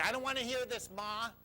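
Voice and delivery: high, loud acted-out boyish protest; protesting child. Boy to his mother, covering ears, high loud protest.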